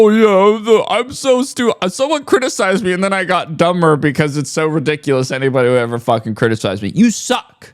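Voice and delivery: rushed, derisive imitation of his complaint; mocking imitation. Critic parodies a creator reacting to being criticized, mocking.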